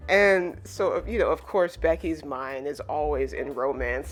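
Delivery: ordinary talk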